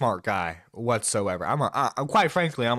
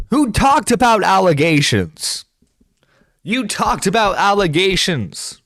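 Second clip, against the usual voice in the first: incredulous, raised-voice tirade; outraged rant; 6 semitones higher, 9 dB louder than usual. Real, not acted. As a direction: hammer each phrase out at full voice. Outraged rant at apology video.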